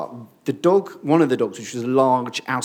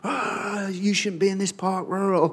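higher, half-hearted imitation of a shouting man; mock angry yell; 6 semitones higher, 3 dB quieter than usual. Dog owner ranting at the preacher, mild mock yell. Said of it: "This man started screaming and swearing at me".

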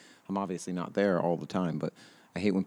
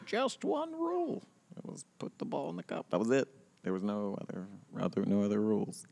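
put-on old-timer voice; 6 semitones higher than usual. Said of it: "I was like".

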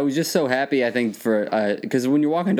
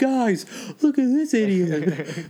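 high, slow mock announcement; drawn-out high-pitched heckle; jeering mechanic; 9 semitones higher, slower than usual. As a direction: call the others over to ridicule an idiot, hammed up. Acting out mechanics mocking a customer.

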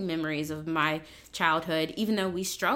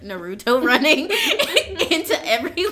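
laughing, higher voice; 5 semitones higher, 8 dB louder than usual. Real, not acted. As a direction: giggle through the line. Laughing while speaking.

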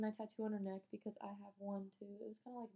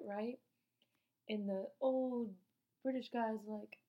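ordinary talk

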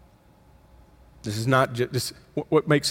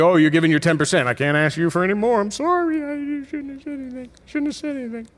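mock God apologizing; 11 semitones higher than usual. Pastor mocks a God who apologizes for asking, high and sheepish. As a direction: play God timidly backing off, voice climbing high. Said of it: "God doesn't look at you".

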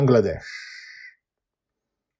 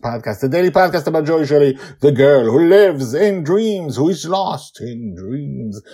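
show host announcement; 11 semitones higher, 13 dB louder than usual. Acted. Host welcomes listeners back over music, big announcer delivery. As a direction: belt out the welcome, then sell the show.

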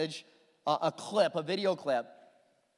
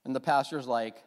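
usual speaking voice

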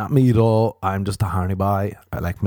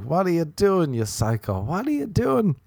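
flames scolding; 8 semitones higher than usual. Pretend. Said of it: "being like".

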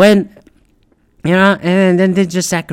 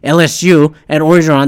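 usual speaking voice

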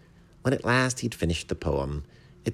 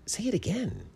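pleasantly astonished; surprised, pleased. A narrator's reading. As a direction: ask in high-pitched, delighted wonder. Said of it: "in a surprised, pleased voice".

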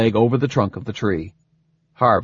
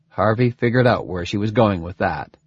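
ordinary delivery, nothing marked

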